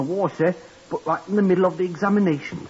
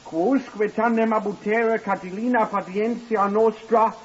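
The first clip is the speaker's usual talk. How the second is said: reciting Latin; 6 semitones higher than usual. Acted. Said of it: "And I know Latin".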